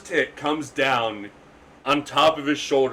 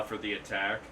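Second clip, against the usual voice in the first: ordinary talk, nothing marked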